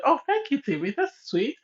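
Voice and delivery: sarcastic. Retold or imitated. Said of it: "how sarcastic Zita is".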